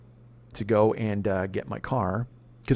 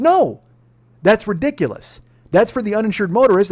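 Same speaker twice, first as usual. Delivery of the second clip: high, loud furious outburst; angry customer yelling at the insurance agent; retold angry yelling; 6 semitones higher, 5 dB louder than usual. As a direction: let him have it, voice climbing and loud. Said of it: "I'm louder".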